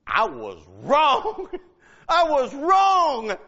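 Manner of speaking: slow, drawn-out confession through laughter; laughing admission. Acted. [name] answers for what he said, laughing admission. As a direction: draw out each word, laughing as you own up.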